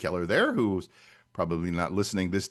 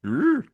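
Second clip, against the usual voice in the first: high loud ugh, fed up with the heat; groan of heat; 13 semitones higher, 4 dB louder than usual. Real, not acted. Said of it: "That's my hot face".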